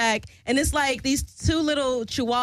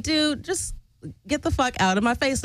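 ordinary delivery

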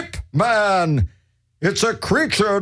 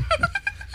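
high giggle; 7 dB quieter than usual. Acted.

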